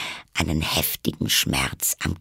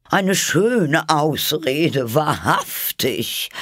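high, slow, scornful bird voice; deep contempt; 7 semitones higher, 4 dB louder, much slower than usual. A narrator's reading. Pigeon dismisses Alice's excuse, contemptuous. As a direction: draw it out with heavy scorn. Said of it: "im Tone tiefster Verachtung".

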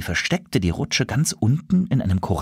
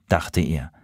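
ordinary delivery, nothing marked

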